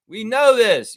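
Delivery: know punched high and loud; shouted. Real, not acted. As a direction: yell We know this. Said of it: "repeated, shouted".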